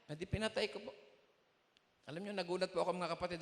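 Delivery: normal talking voice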